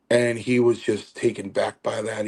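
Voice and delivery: ordinary talk, nothing marked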